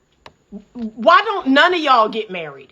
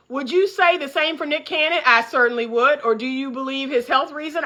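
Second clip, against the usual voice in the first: normal talking voice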